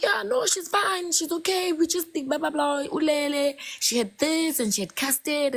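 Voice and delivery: mock the friend's airy reassurance; imitating friend. Friend telling panicked mother to calm down, high mock voice.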